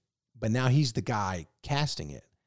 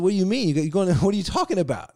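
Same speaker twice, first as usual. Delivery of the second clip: incredulous friend; 8 semitones higher, 5 dB louder than usual. Retold or imitated. Friend's disbelief retold.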